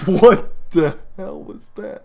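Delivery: slow, alarmed what-the-hell; startled. Real, not acted. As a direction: react to a sudden crash. Reacts to crash sound.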